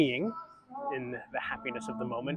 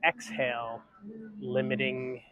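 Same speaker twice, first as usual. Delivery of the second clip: guided breathing; 3 semitones higher than usual. Acted. Leads a breathing exercise.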